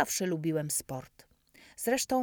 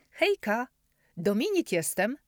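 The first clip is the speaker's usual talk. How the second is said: cheerful greeting; 6 semitones higher, 5 dB louder than usual. Acted. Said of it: "przedstawił się bez zbędnych wstępów".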